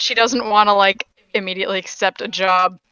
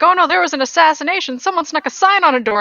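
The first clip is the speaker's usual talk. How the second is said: higher, louder, faster mock panic; mock alarm; 9 semitones higher, 5 dB louder, faster than usual. Player imagines the city's reaction to an assassination, in mock alarm. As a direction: play an alarmed townsperson spreading news.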